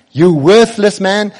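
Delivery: loud, high, drawn-out accusation; shouted insult. Acted. Shimei repeats his insult to the king, loud slow shout. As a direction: hurl it slowly and loudly. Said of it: "He shouts this at the king".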